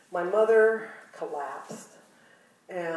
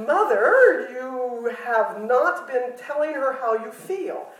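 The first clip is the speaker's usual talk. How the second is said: daughter's scolding; 4 semitones higher, 7 dB louder, slower than usual. Retold or imitated.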